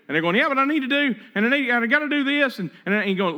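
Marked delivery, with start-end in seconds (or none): 0.4-2.6 higher, pushy hurried protest; mock an impatient friend who won't stop; anxious insistence. Friend refusing to stop, higher and insistent.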